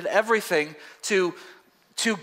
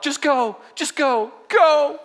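mock movie farewell; 7 semitones higher, 4 dB louder, slower than usual. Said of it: "the movies when they say the dog".

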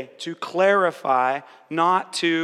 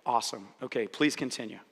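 soft encouraging reply; 6 semitones lower, 6 dB quieter than usual. Shown as a demonstration. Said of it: "then you say".

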